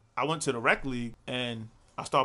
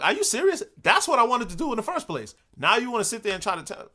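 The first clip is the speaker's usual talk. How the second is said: exasperated disbelief; 7 semitones higher, 3 dB louder than usual. Retold or imitated. Mom suggests high school, exasperated.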